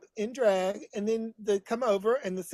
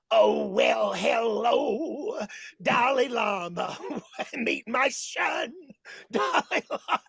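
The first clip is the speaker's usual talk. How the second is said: Carol Channing impression; much slower than usual. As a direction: ham it up like a stage grande dame. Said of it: "I'm doing impersonations in this show".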